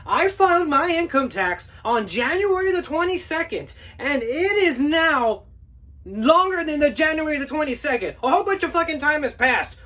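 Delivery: high, loud indignant rant; ranting homeowner. Acted. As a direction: vent every grievance at him. Homeowner rants about tax return.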